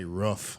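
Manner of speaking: "roof" with a short vowel, like "ruff"; regional pronunciation. Shown as a demonstration. Showing the family's Wisconsin way, "roof" with a short vowel. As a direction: say "roof" to rhyme with "hoof". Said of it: "I used to say roof instead of roof".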